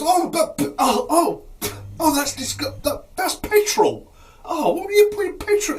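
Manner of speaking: very high, loud, choking outrage; disgusted spluttering. Acted. Patient gets petrol dropped in mouth, shrieky acted disgust. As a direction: splutter as if tasting something vile.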